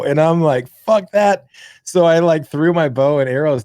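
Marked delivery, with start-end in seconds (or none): 0.7-1.5 higher, louder defiant refusal; boy refusing to face his mom, high loud defiance; defiant kid. Retold or imitated. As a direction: blurt it out as a cornered kid. Kid defies mom in story.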